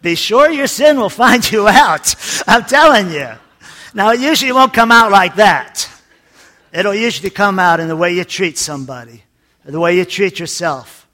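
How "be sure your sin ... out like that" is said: higher, louder forceful warning; preacher at full force warning the congregation; preaching hard. Real, not acted. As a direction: drive the warning home like a preacher. Preacher hammering the warning home.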